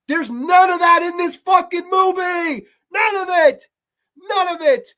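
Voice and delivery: furious shout, loud and high; shouting NONE at the screen; angry rant. Real, not acted. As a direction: yell it out in disgust. Ranting at the movie.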